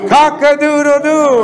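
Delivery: a high, loud cock-a-doodle-doo; American rooster crow. Shown as a demonstration. Teacher shows the class the American rooster crow. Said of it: "How do roosters crow in America?".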